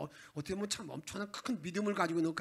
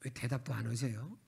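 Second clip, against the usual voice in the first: usual speaking voice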